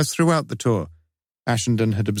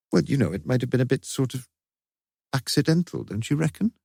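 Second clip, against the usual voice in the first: higher-pitched, hesitant character voice; tentative Lewis; 5 semitones higher than usual. A narrator's reading. Lewis suggests an excuse to Morse, tentative higher voice. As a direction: feel your way to the suggestion, pitch climbing.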